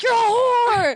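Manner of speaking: high-pitched mock screaming outburst; imagined texter suddenly screeching an insult, hammed-up; screaming fit. Friend imagines the texter raging at her in screaming fits, mock scream. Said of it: "screaming fits at you where he's like".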